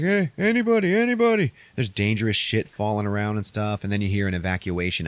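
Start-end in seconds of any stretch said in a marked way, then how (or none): 0.0-1.5 high, louder call for anybody in the dark. Retold or imitated. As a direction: shout out hoping someone answers, pitched well up. Calling out through debris.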